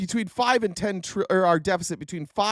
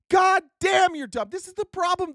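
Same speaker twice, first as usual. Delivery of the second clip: exasperated outburst; 9 semitones higher than usual. Real, not acted.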